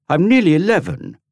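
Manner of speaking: put-on very high, loud indignant boy; furious protest. Dorian to Tom, high loud indignant protest. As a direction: insist on your age. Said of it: "Dorian protested furiously".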